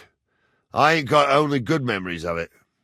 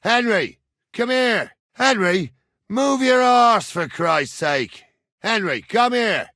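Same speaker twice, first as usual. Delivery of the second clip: high, raised summoning shout; impatient calling; 8 semitones higher than usual. Acted. Man ordering Henry to come here, calling impatiently. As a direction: call him over, losing patience.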